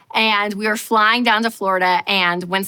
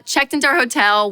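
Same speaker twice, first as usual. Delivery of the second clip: normal talking voice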